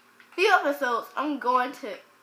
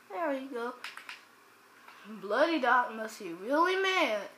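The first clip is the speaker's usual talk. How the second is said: normal talking voice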